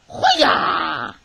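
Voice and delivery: loud ninja kiai, falling at the end; ninja battle cry. Pretend. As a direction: shout it like a ninja. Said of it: "You like my ninja voice?".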